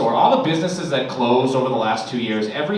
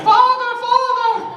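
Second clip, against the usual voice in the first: very high, loud Civil War messenger cry; urgent messenger; 6 dB louder than usual. Pretend. Kids as battlefield messengers, very high loud cry. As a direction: cry out like a frantic soldier with news. Kids bringing news from front.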